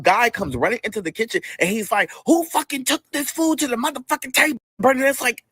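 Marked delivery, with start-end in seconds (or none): 2.3-4.6 imitated angry demand. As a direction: demand the culprit in a higher put-on voice. Acted angry man storming into kitchen.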